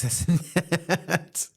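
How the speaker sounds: slow, high laugh through the words. Real, not acted. Wrapping up the wig feud story, cracking up. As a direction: let the laugh take over.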